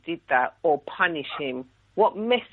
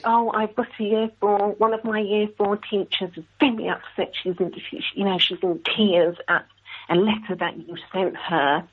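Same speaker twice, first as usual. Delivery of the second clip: lightly voiced deputy head, slightly raised pitch; concerned deputy head; 4 semitones higher than usual. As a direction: gently mimic a school official raising a complaint. Retold phone call from deputy head.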